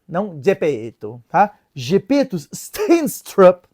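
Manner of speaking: normal talking voice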